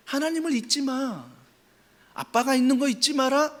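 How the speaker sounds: father's heart. Acted. God speaking as a father to his fearful child, tender. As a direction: speak like a loving dad. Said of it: "아빠의 마음으로".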